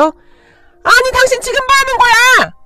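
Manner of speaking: very high, slow, indignant shriek; scandalized high-pitched yell; shocked outcry. A narrator's reading. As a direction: cry out in outraged disbelief. Shocked outcry after the shove.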